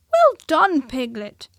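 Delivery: loud, high hearty praise; praising Piglet. A narrator's reading. Christopher Robin encouraging Piglet, loud hearty praise. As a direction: cheer him on.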